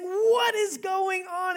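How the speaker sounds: high, slow, outraged cry; mock outrage, drawn out; storming in. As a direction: burst in demanding answers. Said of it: "Martha comes storming in saying".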